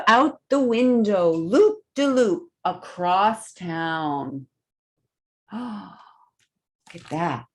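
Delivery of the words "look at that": a soft gasp, then a hushed slow look at that; hushed gasp of amazement; sharp gasp. Pretend. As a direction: gasp in wonder at the picture. Reader marvels at the flying note.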